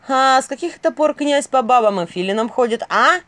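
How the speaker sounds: loud, high, cheeky challenge; brazen. A narrator's reading. Filin to the guards at the door, brazen challenge. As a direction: ask it insolently, punching the final word. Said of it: "спросил Филин нагловатым голосом".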